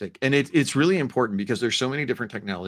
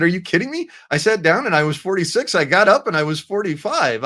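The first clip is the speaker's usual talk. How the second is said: higher and louder, thrilled retelling; gleeful boast; 7 semitones higher, 6 dB louder than usual. Retold or imitated. Telling his wife he's younger, higher and louder in gleeful delight. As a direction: crow about gaining a year. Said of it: "I said".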